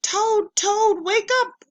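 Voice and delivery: high, loud wake-up call. Acted. Frog loudly wakes sleeping Toad. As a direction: call him awake excitedly. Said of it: "said Frog".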